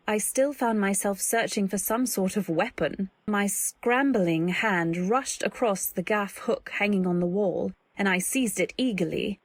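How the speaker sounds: ordinary delivery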